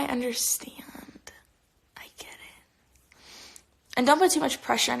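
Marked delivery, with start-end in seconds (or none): 2.0-3.2 whispering. Real, not acted. Comforting a stressed viewer.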